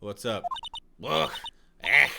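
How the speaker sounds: put-on huffing and puffing old smoker; hammed-up puffing, out of breath; wheezing smoker character. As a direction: grunt between greetings as if winded.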